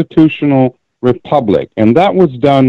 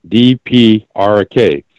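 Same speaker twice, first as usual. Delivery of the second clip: D P R K, each letter slow and separate; letters D P R K set apart one by one; slow spelled out; slower than usual. Shown as a demonstration. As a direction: spell out D P R K deliberately.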